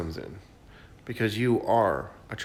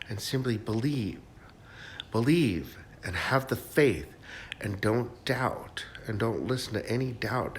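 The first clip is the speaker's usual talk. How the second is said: ordinary talk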